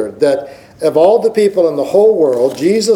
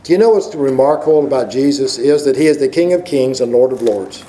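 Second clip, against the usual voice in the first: normal talking voice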